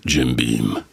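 very low-pitched commercial voiceover; deep TV-spot character voice; ad-spot bar customer. Acted. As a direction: drop right down for a man ordering in a bar. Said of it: "kommt ein Mann in eine, in eine amerikanische Kneipe".

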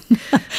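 amused giggle. Real, not acted. Teases the listener with a secret.

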